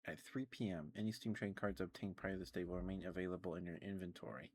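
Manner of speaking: ordinary talk, nothing marked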